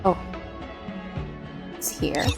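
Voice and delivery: ordinary talk, nothing marked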